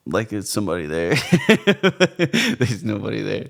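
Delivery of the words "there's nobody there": laughing through the words; slow, amused laugh. Real, not acted. Laughing at talking to camera.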